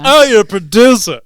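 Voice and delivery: loud, high, exaggerated fawning; hammed-up LA pretension at full volume; mocking Hollywood snob. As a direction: imitate a Rodeo Drive showbiz type. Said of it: "the way it was said".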